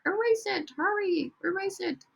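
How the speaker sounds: high urgent rush to erase it; urgent high hurry. Acted. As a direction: sound alarmed and hurried, teacher urging fixes. Urging students to fix mistakes.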